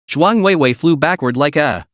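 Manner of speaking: usual speaking voice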